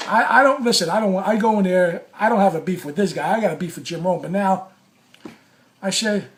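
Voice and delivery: usual speaking voice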